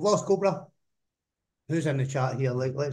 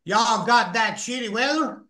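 attempted Texas accent, pitched up; 4 semitones higher, 4 dB louder than usual. Shown as a demonstration. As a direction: put on a rough imitation of a Texan. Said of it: "the Texas accent".

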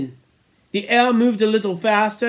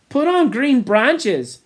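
Morris's voice; 6 semitones higher, 4 dB louder, slower than usual. Acted. Morris suggesting.

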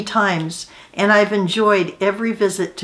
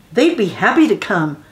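ordinary delivery, nothing marked

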